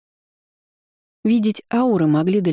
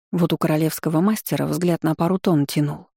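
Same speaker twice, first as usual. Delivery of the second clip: ordinary delivery, nothing marked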